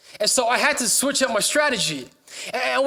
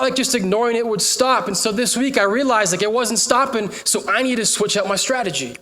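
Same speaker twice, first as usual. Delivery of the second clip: ordinary delivery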